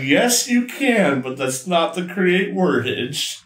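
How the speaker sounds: loud, high mock outburst; exasperated mock outburst. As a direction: belt it out high, drawing out the yes. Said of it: "that's what I was like".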